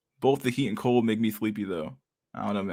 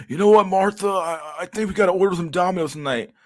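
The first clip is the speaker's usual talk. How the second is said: a higher put-on husband, pleased with himself; 8 semitones higher, 4 dB louder than usual. Streamer voicing a husband suggesting Domino's to Martha, higher and louder. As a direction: play the husband announcing takeout like a treat. Voicing couple ordering pizza.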